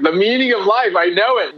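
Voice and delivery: high, elated declaration; euphoric. Retold or imitated. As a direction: announce it with bursting joy. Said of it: "I was like".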